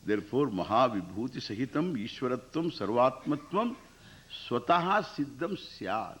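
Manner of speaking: ordinary delivery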